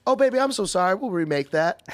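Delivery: high, loud sweetly apologetic voice. Retold or imitated. The cook surprises the customer with kindness, high sweet coo. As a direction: coo it like a doting auntie. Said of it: "So sweet about it".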